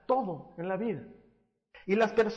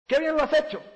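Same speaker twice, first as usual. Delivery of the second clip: "Qué bien lo has hecho" said higher and louder, bright praise; 8 semitones higher, 4 dB louder than usual. Shown as a demonstration. Pastor showing praise in words alone, louder and higher. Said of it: "funciona mejor la palmadita".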